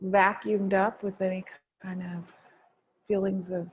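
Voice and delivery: guided meditation. Acted. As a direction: gently guide them inward. Leads a gratitude meditation.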